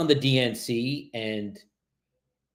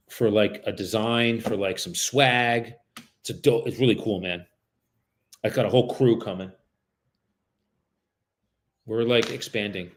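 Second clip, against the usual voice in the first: usual speaking voice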